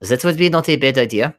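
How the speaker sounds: a lightly put-on German accent, half joking; mock German accent. Comic writer asking the stream host for a link, in a hammed-up German accent. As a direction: slip into a bad German accent for fun. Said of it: "Why am I talking like German?".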